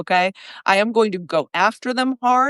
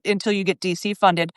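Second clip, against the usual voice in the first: ordinary talk, nothing marked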